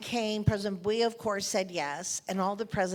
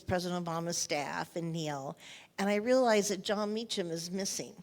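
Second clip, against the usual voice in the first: normal talking voice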